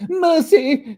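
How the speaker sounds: high, loud terrified plea; begging for mercy. Acted. Scrooge kneeling before the ghost, terrified high cry. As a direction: cry out on his knees. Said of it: "fell upon his knees and clasped his hands".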